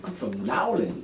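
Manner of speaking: put-on New Orleans accent. Enjoying the way they talk, imitated New Orleans accent. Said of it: "I like the way they talk".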